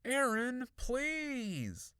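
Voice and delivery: high, insistent plea; beg, pitch way up; pleading. A narrator's reading. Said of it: "I pleaded".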